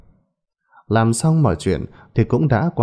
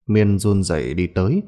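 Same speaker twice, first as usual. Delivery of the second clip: ordinary delivery, nothing marked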